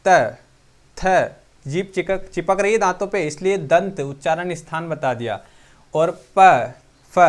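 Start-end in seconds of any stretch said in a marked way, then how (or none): 0.1-0.4 त said clearly and loud, tongue on the teeth; loud demo of त. Shown as a demonstration. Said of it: "जैसे ही त बोलोगे". 5.9-6.7 प said loud with both lips pressed; loud demo of प. Shown as a demonstration. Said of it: "प बोल के देखो".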